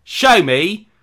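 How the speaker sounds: very loud, high, slow hammy Cockney; over-the-top Cockney movie villain, every word belted out; Michael Caine Cockney gangster. Acted. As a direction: shout it as a drawn-out Cockney gangster. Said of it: "a Cockney gangster accent".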